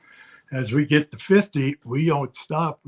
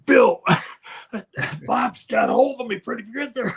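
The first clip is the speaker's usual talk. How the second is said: higher, louder hammed-up wrestler's groan of pain; selling the hold; 9 semitones higher, 4 dB louder than usual. Wrestler telling referee Bill he's caught, hammed up. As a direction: oversell being caught to the referee. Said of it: "He's selling".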